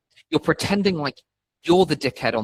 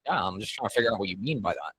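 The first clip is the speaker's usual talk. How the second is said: calm, soft reply; 4 semitones lower, 7 dB quieter than usual. Retold or imitated. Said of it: "And I'll be like".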